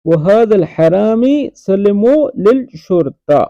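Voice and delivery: Arabic line drawn out slowly and clearly; slow model reading. Shown as a demonstration. Teacher slowly models the Arabic line for students. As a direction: say it slowly so learners can follow. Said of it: "teacher repeats the line slowly".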